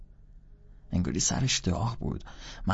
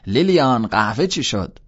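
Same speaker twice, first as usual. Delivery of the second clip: loud, raised call to someone in another room; shouting; 4 semitones higher, 8 dB louder than usual. A narrator's reading. Antolini shouts to his wife in the kitchen about coffee, shouting. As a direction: call out loudly towards the kitchen. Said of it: "داد زد".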